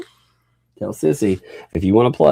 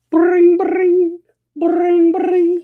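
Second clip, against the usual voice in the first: high, loud trilled ringing noise; imitated phone ring; 6 dB louder, much slower than usual. Dad faking an incoming call for his kid, high phone-ring noise. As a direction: squeal out a telephone ring.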